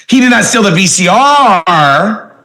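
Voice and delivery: V C R drawn out at the end; stress on V C R. Shown as a demonstration. Trainer shows class voice inflection, stress on V C R. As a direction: stretch the R of V C R long. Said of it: "just by using voice inflection".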